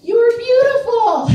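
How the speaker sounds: high, loud, drawn-out shout; yelling out. Retold or imitated. Man yelling to a woman driver at an intersection, yelling out. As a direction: holler it like a catcall from the next car. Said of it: "yells out".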